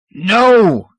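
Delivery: loud high roar. A narrator's reading. Hegrin roaring at Scomber, loud. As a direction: roar the refusal. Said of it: "Hegrin's mental roar".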